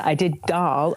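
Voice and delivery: usual speaking voice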